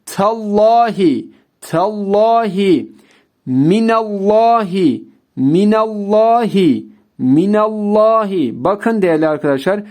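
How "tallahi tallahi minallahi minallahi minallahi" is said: tallahi and minallahi with a heavy, dark lam; dark lam throughout; thick lam. Shown as a demonstration. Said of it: "yine kalın okudum".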